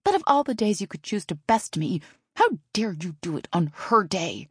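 dripping with venom; venomous. A narrator's reading. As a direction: spit it out with venomous indignation. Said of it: "dripping with venom".

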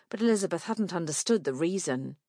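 plain reading voice

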